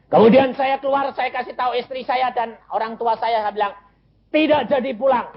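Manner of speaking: high, excited testimony; impassioned preaching. Real, not acted. Preacher recounts telling wife and parents, high and impassioned.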